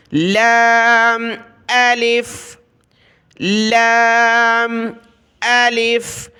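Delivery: drawn-out لام الف; recited lam alif. Shown as a demonstration. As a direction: recite the joined letters. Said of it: "دو حرف آپس میں ملے ہیں".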